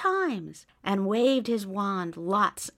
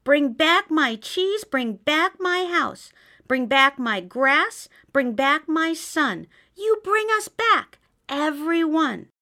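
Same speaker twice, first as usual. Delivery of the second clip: high, louder pleading demand; demanding mouse; 6 semitones higher, 4 dB louder than usual. A narrator's reading. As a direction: insist on it all back, little mouse. Mouse demands everything back.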